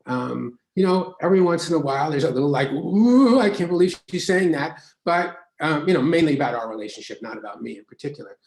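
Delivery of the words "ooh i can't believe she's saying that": mock shock. Wife's mild shock voiced.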